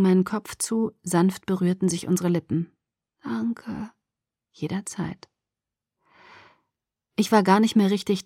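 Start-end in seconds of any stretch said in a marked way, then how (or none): 3.2-3.9 faint, sleepy thanks; drowsy. Acted. Sedated patient after a kiss. 4.5-5.3 soft, tender half-whisper. Acted. Tender reply at her ear.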